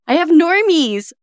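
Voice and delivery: high, giddy mock celebrity voice; mock diva. Boasting about her little fan club, high giddy mock diva. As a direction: gush like a star with a fan club. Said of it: "It's like".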